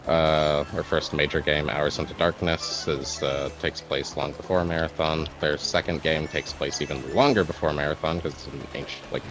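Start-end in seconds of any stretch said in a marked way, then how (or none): none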